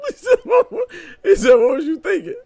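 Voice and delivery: high, loud yell through laughter; real laughing shout. Real, not acted. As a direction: shriek it out laughing. Laughing while retelling.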